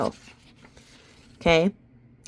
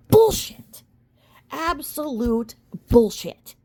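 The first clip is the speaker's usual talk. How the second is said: scornful outburst; 5 semitones higher, slower than usual. Real, not acted. Vlogger calling a claim false, scornful outburst. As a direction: spit out the verdict in disbelief.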